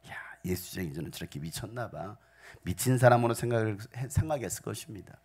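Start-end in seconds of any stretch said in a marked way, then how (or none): 0.1-2.2 scornful whispered gossip. Retold or imitated. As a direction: whisper it behind their backs. Onlookers mock believers. (수군거리는 속삭임)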